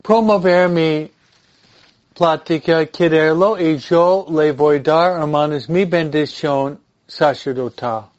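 ordinary delivery

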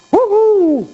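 high, loud drawn-out woo; high whoop. Real, not acted. Reacts to a strong statement. (silbido de asombro con la voz, agudo)

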